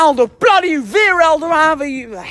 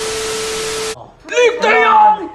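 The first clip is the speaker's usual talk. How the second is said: high, excited fan yelling; real high-pitched excitement; stunned goal shout; 5 semitones higher than usual. Real, not acted. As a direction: shout in disbelief at a sudden goal. Shock goal seen live.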